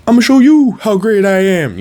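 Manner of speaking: very high, loud, hammed-up bragging voice; mock boastful affirmation. Mocking show-off self-affirmations, very high and loud. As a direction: brag like a cartoon show-off. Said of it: "I'm not talking about, like, the".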